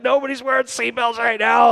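high, loud exasperated shout; acted-out flustered shouting; frantic dad yell. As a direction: yell back at the kid in a panic. Acts out frantic dad yelling back.

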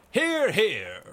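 loud, high pub cheer; crying out. A narrator's reading. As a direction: cheer it out with the crowd. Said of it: "cried the voices, young and old".

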